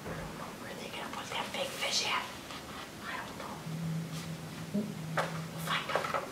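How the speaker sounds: hushed, secret aside; whispered aside. Real, not acted. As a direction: whisper it to the listener. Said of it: "whispered aside to listener".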